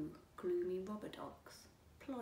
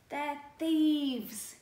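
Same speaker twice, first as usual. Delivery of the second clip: loud, high indignant cry; loud cross neighbour; 6 semitones higher, 11 dB louder than usual. A narrator's reading. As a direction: sound outraged at the thieves. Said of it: "he said crossly".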